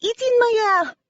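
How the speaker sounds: put-on high, loud French-accented cry of alarm; French woman shrieking in panic; screamed French accent. A narrator's reading. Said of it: "she screamed in a pretty French accent".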